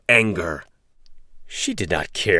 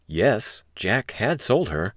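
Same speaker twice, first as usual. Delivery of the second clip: usual speaking voice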